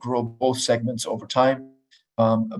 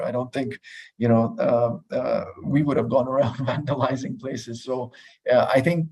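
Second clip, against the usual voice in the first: ordinary talk, nothing marked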